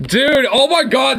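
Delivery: ordinary talk, nothing marked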